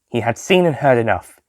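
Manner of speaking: ordinary delivery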